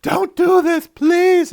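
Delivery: high, loud desperate pleading; begging. Retold or imitated. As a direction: beg for your life, voice straining high. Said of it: "Tuvix was begging for his life".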